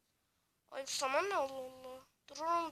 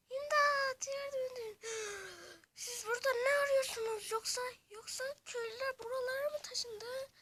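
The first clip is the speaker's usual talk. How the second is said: high, teary villager voice; whimpering villager; 10 semitones higher, 6 dB louder than usual. Acted. Villager talking to the rescuers, tearful. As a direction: plead like a scared little villager.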